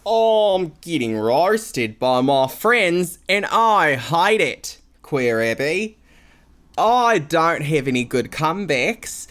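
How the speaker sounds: put-on reading a listener letter in a broad Australian accent, slow and loud; hammed-up Australian drawl that drifts British by the end. Host reads the letter aloud in an Australian accent. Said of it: "should I read this one in an Australian accent".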